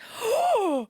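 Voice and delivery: big high gasp of astonishment; high theatrical gasp; shocked gasp. Real, not acted. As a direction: gasp loudly, as if scandalised. Mock shock at Paul praising a film.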